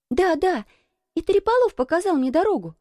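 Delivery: put-on high-pitched, insistent little girl; high girlish insistence. A narrator's reading. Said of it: "настаивала девочка".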